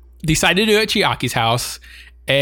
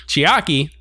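ordinary delivery

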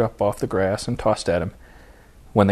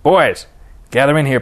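raised, louder summons; loud, higher-pitched coach's call; 5 semitones higher, 7 dB louder than usual. A narrator's reading. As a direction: call the team in across the field. Manager calls players in.

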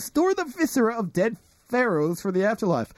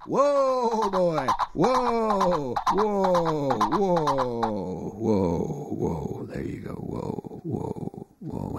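soothing a horse; 3 semitones lower, much slower than usual. Acted. Calming the pony.

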